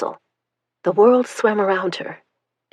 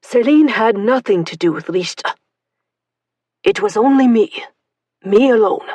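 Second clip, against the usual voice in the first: thick and muffled; 3 semitones higher, 5 dB louder than usual. Acted. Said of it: "Michel's voice, thick and muffled".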